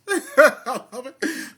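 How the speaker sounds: loud high burst of laughter; loud high-pitched laugh; big laugh. Real, not acted. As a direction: crack up out loud. Bursts out laughing mid-talk.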